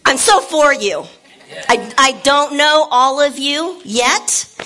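loud, high preaching to the crowd; full-force preaching. Acted. Pastor rallying the church, loud and fired up. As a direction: preach it out big and bold.

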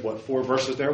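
ordinary talk, nothing marked

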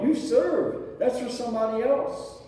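ordinary talk, nothing marked

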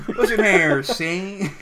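put-on old-time gangster, loud and laughing; mobster voice. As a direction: talk like a 1930s mobster. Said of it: "You're like a mobs-, mobster now".

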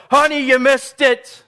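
high, loud, laughing call to the other room; excited fan calling out. Retold or imitated. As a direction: yell to your spouse you missed the play. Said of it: "You're like".

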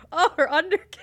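giggling through the words; laughing. Real, not acted. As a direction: laugh as you say it. Laughing while recalling.

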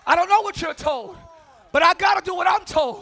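loud, high shouted preaching; shouting sermon climax; full-force preaching. Acted. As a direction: preach it hard to the church.

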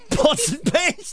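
high, slow prospector drawl; old prospector voice. Pretend. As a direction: play a wacky old gold miner.

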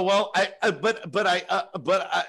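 retold helpless stuttering; flustered stammer. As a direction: try to get a word in and keep failing. Stammering reply while being yelled at.

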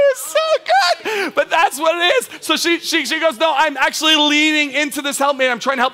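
high, loud, still laughing; preacher laughing through the wife's line; laughing through words. Real, not acted. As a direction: talk while you can't stop laughing. Still laughing after the story.

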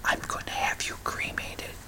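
barely voiced whisper, very soft; a hushed demo whisper for his wife's ear. Shown as a demonstration. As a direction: breathe it right into her ear. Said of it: "I would whisper something like".

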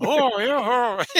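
put-on high, loud doddering old man. Old father in the sketch answering his son. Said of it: "one of them would go like".

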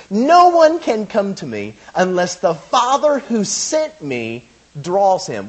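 slow, high, loud scripture proclamation; emphatic preaching. Acted. Preacher quoting Jesus' key verse to the congregation, emphatic. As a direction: draw out each phrase with weight.

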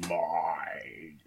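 booming then fading dark lord; slow menacing villain, echoing; echoing voice inside helmet. Acted. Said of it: "You sound more like Nagash than Chaos".

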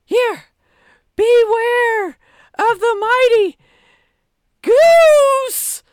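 high and loud, building to a shout on goose; booming villain reveal. Acted. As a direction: unveil the monster with a roar.

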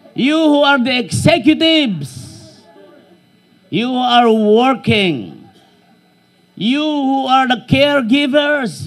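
loud, slow, emphatic sermon delivery; full-force preaching. Acted. Preaching to the congregation.

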